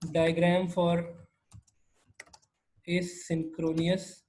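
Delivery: slow, drawn-out words while typing; typing aloud. Real, not acted. Teacher typing a bookmark name for the class, slow dictation. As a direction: say each word slowly as you type it.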